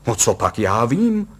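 booming, furious retort; loud blustering husband; thundering. A narrator's reading. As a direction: roar back in exasperation. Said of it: "burácel pan Kalous".

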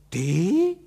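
high, loud, disdainful query; contemptuous. A narrator's reading. Wife doubting her husband, contemptuous. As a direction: scoff with the pitch leaping up. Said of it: "s jakýmsi opovržením".

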